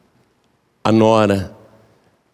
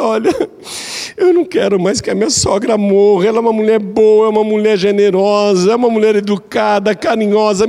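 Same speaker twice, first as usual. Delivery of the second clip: high, urgent begging voice; pleading daughter-in-law; 9 semitones higher than usual. Retold or imitated. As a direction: beg the wise man for the antidote. Daughter-in-law begs the wise man. (nora arrependida implorando, voz aguda)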